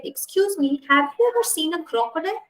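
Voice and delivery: high, quick little elephant voice; a hint of an eager baby elephant, fast and high; curious baby elephant. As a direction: pipe up politely like a small child. Baby elephant asks the crocodile.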